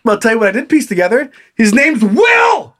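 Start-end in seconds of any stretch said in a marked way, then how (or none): 1.5-2.8 much higher, loud, slow declaration; mock-dramatic shout; dramatic reveal. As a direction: announce the name like a big reveal. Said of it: "dramatic reveal".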